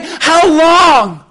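loud, high, drawn-out sarcastic question. Retold or imitated. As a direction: throw it out as exasperated, pointed sarcasm. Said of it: "saying this in a sarcastic way".

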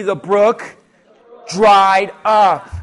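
loud, with the congregation, punching dried; call and response. Shown as a demonstration. Preacher leading the church in saying it together, loudly. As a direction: lead the crowd and shout dried up. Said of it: "Everyone say that with me".

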